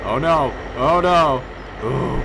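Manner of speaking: loud rising panic, oh no; real alarm, shouted high; alarmed cry. Real, not acted. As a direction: yelp as it slides away. Sliding down out of control.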